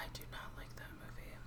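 hushed aside, barely voiced; whispering. Real, not acted. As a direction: whisper it under your breath. Aside during movie talk.

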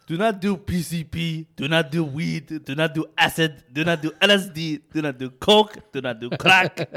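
hammed-up African-accented anti-drug announcement; Hakeem Olajuwon. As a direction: rattle off mock warnings, punching each drug. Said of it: "he just listed off a bunch of drugs".

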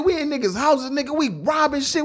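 character voice. Retold or imitated. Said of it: "He said".

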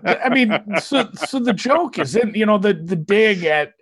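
laughing through the words; chuckling while explaining the joke. Real, not acted. Laughing at his own joke.

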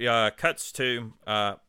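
ordinary talk, nothing marked

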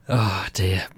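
usual speaking voice

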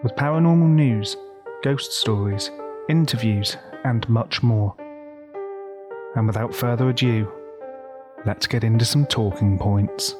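loud announcer intro over music; ominous late night radio. Acted. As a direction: boom it out like a radio host.

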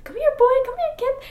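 high calling voice searching for a lost dog. Retold or imitated. Calling dog toward the noise.

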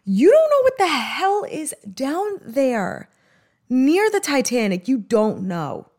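worked-up, emphatic and drawn out; passionate. Real, not acted. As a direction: get heated, pitch lifted, stretching the words. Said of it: "I got really passionate".

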